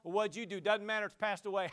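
normal talking voice

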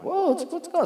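sudden high, alarmed question; startled concern. Retold or imitated. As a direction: jump up in pitch, caught off guard. Retelling his friend breaking down.

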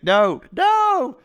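high squeaky mock refusal; mock protest. Jack squealing at chocolate held before him, high mock protest.

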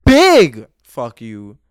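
huge drawn-out blast on big; emphatic loud insult. Real, not acted. Podcaster sending an insult to [name], bellowed. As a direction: belt it out slowly and very loud.

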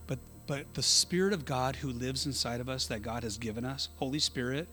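ordinary talk

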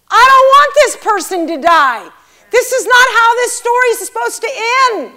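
loud, high-pitched retold outcry; anguished protest. Believer pleading with God for someone's life, loud anguished protest. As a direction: protest at full voice, pitch climbing.

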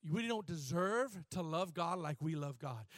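ordinary talk

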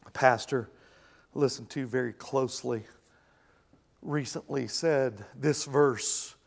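low, soft and grave; serious. Real, not acted. Pastor turning from joking to grave warning, low and soft. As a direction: drop the jokes and speak gravely. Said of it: "Let me say this with all seriousness".

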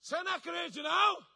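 high, loud mock scolding shout; exasperated rebuke. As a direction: bawl out a hopeless believer, stretching the insult. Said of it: "se fosse eu falar assim".

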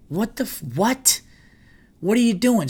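high, incredulous what are you doing; outraged disbelief. Retold or imitated. Watching the clerk ruin the bag, shocked and high. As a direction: voice shoots up in disbelief.

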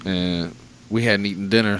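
ordinary talk

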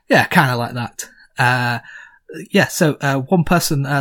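ordinary talk, nothing marked

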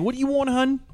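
lightly imitating a Baltimore waitress saying hun. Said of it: "call everybody hun".

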